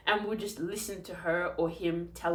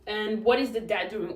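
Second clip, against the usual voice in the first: normal talking voice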